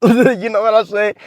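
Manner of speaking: high-pitched, chuckling through the words; laughing. Shown as a demonstration. Showing guys how to laugh with a girl, laughing. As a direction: let a laugh lead into the line. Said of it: "To laugh, be like".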